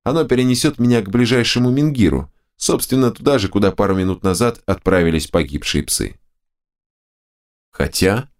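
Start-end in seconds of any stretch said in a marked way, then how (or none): none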